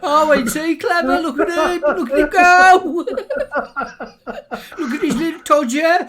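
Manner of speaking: hammed-up Brummie accent, high and loud with laughter; mock Brummie. Laughing mock Brummie cooing at the video. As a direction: Brummie gran cooing over a naked man, laughing. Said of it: "this Brummie accent".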